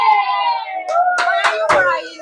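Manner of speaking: very slow, high cooing question; mock concern. Mock-asking a crying child, slow high coo. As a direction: coo it like to a baby.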